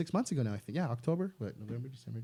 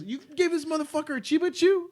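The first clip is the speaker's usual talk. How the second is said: shocked; 12 semitones higher than usual. Retold or imitated. Chad shocked at Ralph.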